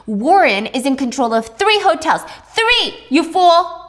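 mocking mother who snaps into a shout; mother berating. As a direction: jab the word Three at her, then call her a fool.

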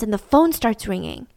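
usual speaking voice

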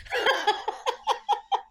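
a squealing laugh far above her voice; high giggle burst. Real, not acted. Laughing at her own dreamy answer, high giggle. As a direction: let out a high delighted giggle.

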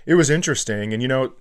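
ordinary talk

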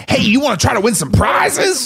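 high-pitched, shouted carnival pitch; carnival barker. Retold or imitated. Skinny bald carny luring a kid to play, mock barker shout. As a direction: holler it like a pushy barker. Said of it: "and he was like".

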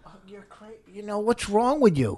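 high mocking echo of her words; sarcastic parroting; mocking mimicry. Mocking wife's imitation back.

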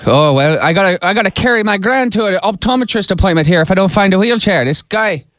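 put-on a light Irish accent on a prank call. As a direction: play an Irish caller hunting for his brother-in-law. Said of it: "Jim is gonna do his best Irish accent".

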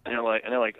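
ordinary delivery

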